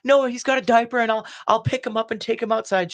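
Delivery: very high, insistent pleading; high-pitched retold protest, refusing to accept it. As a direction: act out a desperate bargain in a high voice. Acted protest to mother's intervention.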